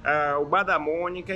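ordinary talk

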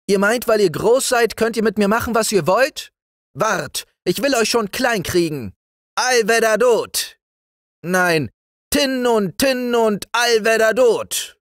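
put-on very high, defiant boy; high-pitched bragging boy's voice; boy calling loudly. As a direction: taunt the big soldiers, then chant the magic word. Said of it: "rief er laut".

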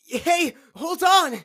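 shouting. Acted. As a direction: yell for them to stop. Calling after a vanishing friend.

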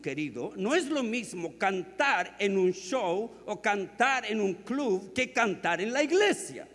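high, loud sermon building to a shout; impassioned preacher's crescendo; full-force preaching. Real, not acted. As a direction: hammer out each contrast to the congregation, climbing to a roar.

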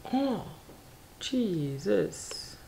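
soft, appalled little exclamation; quiet horror at an ugly sight; hushed dismay. Real, not acted. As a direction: say it under your breath, aghast. Dismayed at horrible paperback cover.

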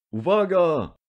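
high, excited shout from the hall; crowd shouting. A narrator's reading. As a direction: call out a warning from the crowd. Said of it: "wołano na sali".

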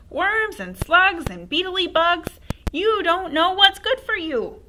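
high, loud crone voice, slow; cackling old crone. Acted. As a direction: gloat like a witch. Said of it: "she said again".

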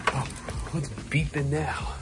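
ordinary delivery

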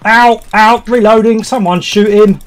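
panicked shouting. Real, not acted. Player shouting at teammates while an enemy rushes him. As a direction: yell for help as he's rushed.

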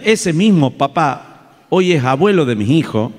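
ordinary delivery

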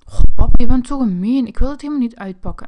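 loud, whiny indignation at dad; wronged child yelling at his father; indignant protest. Acted.

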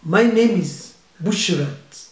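put-on African accent. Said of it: "African accent".

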